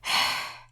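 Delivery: hard sigh through the nose; sharp frustrated exhale. A narrator's reading. Karkat venting at Solix, sharp exhale. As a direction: vent frustration in one breath. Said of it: "exhales sharply in an attempt to vent".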